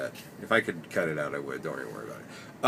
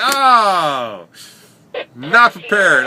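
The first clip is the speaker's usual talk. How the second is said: startled shock; 9 semitones higher, 13 dB louder, much slower than usual. Retold or imitated. Passenger's rear in his face.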